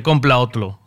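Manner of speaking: Compra otro with r turned to l, komplo otlo; blunt shopkeeper line in a mock Chinese accent; the shopkeeper tells him flatly to buy a new phone, mock Chinese accent. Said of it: "y me dice".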